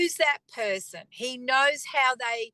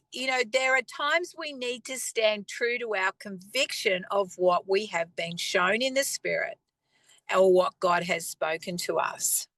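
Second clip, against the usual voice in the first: usual speaking voice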